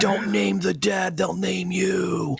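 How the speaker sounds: slow, eerie whisper; cryptic. Pretend. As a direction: intone it slowly like a curse. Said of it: "that sounds so cryptic".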